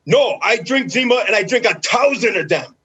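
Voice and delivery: loud, high-pitched teammate impression; hammed-up booming boast; George. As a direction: blurt it out as an indignant, over-the-top caricature. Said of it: "And he's like".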